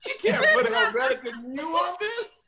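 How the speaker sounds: hammed-up disbelief, laughing through it; mock outrage. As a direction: scold him in fake horror. Mocking friend's font obsession.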